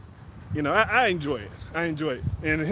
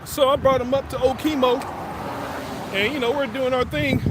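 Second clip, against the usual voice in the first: dad voice, pitched higher; lightly voiced dad, a bit higher; a hint of dad; 5 semitones higher than usual. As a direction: slip lightly into dad telling his story. Dad retelling the story.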